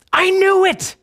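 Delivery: high, loud angry outburst; mad at God. Acted. Jonah blurting his complaint at God, mad. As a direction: burst out in mock fury, pitch way up. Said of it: "He's mad, right? He's mad at God".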